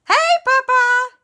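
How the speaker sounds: put-on very high, loud kid shout; high child greeting. Boy greeting his mother, very high and loud. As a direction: squeal hello like a little boy. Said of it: "And Alexander".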